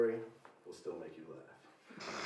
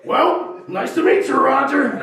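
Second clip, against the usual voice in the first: much higher, louder hammed-up greeting; mock cheery dad; 12 semitones higher, 11 dB louder than usual. Buddy's dad greeting the stranger, high loud cheer. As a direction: greet him with oblivious cheer.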